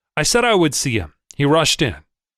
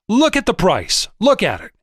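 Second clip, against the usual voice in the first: much higher, sharp angry outburst; exploding in anger; 7 semitones higher than usual. A narrator's reading. Enraged Wolf ordering the trader to look at the price. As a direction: burst out after a long stare. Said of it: "before he exploded".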